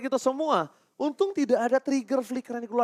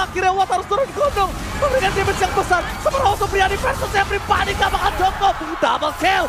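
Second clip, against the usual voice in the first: fighting game announcer; 7 semitones higher, 3 dB louder than usual. Real, not acted. Live play-by-play, announcer build.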